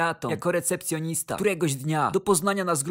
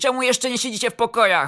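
raised, louder scolding; coach's telling-off; 5 semitones higher, 5 dB louder than usual. A narrator's reading. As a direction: berate the players, voice pitched up. Coach berating his players. (podniesiony głos karcącego trenera)

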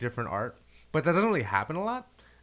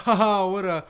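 laughing mock jeer, raised pitch and louder; 4 semitones higher, 6 dB louder than usual. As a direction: imitate a scoffing onlooker through a laugh. Imitating own past mockery.

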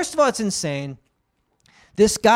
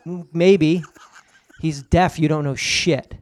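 ordinary delivery

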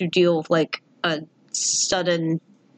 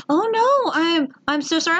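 shocked receptionist; 9 semitones higher than usual. Retold or imitated. Receptionist hearing the cat died, high shocked sympathy. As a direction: gasp out sympathy like a stunned receptionist. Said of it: "the poor receptionist was just like".

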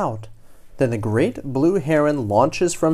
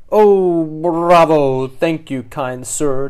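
cheering; 2 semitones higher, 8 dB louder, slower than usual. Acted. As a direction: cheer like a delighted belle. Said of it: "cheers the unfortunate Doreen".

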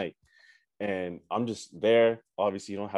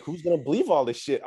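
ordinary talk, nothing marked

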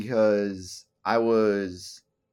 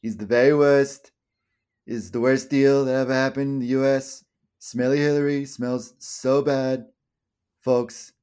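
bad Trump impression; 2 semitones higher, 4 dB louder than usual. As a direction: badly imitate a politician's halting cadence. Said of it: "can't do a good Trump impression".

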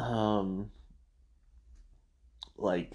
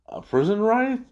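mock surprise; 10 semitones higher than usual. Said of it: "And then Chit Sang".

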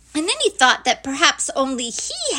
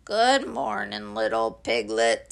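gloomy Eeyore; 13 semitones lower than usual. Acted. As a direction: drag the greeting out glumly, sinking low. Gloomy Eeyore answers Piglet.

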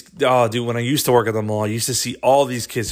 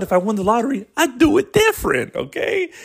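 much higher squeaky mocking tone; 12 semitones higher than usual. Shown as a demonstration. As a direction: jump way up in pitch. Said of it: "But hey, guess what?".

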